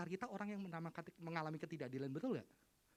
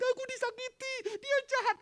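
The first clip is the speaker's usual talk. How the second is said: put-on high, loud childish complaint; mock child's tearful accusation; whiny hurt child; 10 dB louder than usual. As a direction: whine like a wronged kid telling everyone.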